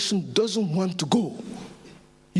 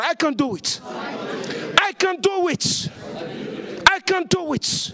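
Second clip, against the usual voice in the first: with conviction; 7 semitones higher than usual. Shown as a demonstration. Preacher urging congregation to declare it, with conviction. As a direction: hammer it home with conviction. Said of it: "say with conviction".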